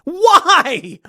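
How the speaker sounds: can't believe what she did; incredulous why. Real, not acted. Reacting to the poster-ripping headline, incredulous.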